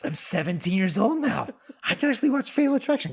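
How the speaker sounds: high, fast, giddy; bursting with eager excitement; a kid who can't believe his luck; excited teen. Retold or imitated. Teen excited about watching the movie.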